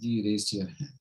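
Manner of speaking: normal talking voice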